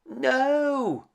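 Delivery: high, loud desperate cry. A narrator's reading. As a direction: scream it from far below. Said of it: "Belle cried from below".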